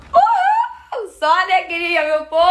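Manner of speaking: high, loud happy cheer; joyful whoop. Real, not acted. Mother cheers her followers after passing, joyful whoop. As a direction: whoop it out for the win.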